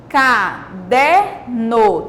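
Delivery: CADERNO very slowly as ca, der, no; syllable by syllable. Shown as a demonstration. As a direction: break CADERNO into three separate beats. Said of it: "também três sílabas".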